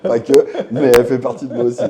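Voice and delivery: talking loud through a laugh. Real, not acted. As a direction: laugh while you admit it. Laughs after being called bon vivant.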